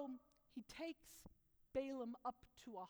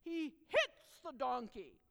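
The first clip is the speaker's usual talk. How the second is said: ordinary delivery, nothing marked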